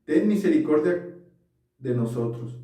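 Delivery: usual speaking voice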